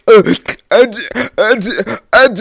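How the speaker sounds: loud, hiccuping fake sobs; mock hard crying. Storyteller imitates Bodysuits crying, exaggerated mock sobs. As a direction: imitate gulping, snot-bubble sobs. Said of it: "talking about that type of cry".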